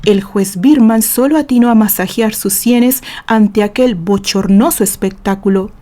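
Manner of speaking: usual speaking voice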